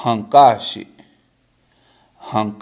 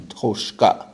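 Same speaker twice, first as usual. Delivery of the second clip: ordinary delivery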